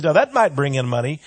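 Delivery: ordinary talk, nothing marked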